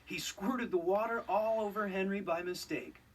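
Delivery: ordinary delivery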